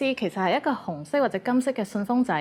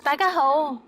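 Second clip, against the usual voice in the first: cheery greeting; 6 semitones higher, 4 dB louder than usual. Shown as a demonstration. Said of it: "say 大家好".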